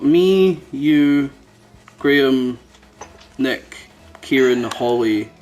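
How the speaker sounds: usual speaking voice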